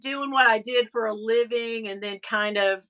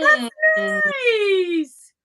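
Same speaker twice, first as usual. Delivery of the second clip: aww that's nice; 6 dB louder than usual. Real, not acted. Reacting warmly to a comment.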